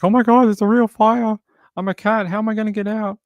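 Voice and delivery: high, loud panicky cat voice; voicing the cat. Owner voices cat panicking at fire alarm, high squeal.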